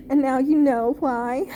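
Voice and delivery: real, overcome with love for the film; crying. Real, not acted. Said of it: "I'm getting choked up".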